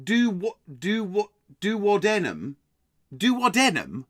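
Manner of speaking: "duodenum" tried questioningly as do-wo-DEN-um; trying suggested pronunciation. Retold or imitated. Quiz host trying out a listener's pronunciation, puzzled do-wo-DEN-um "duodenum". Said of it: "You're saying".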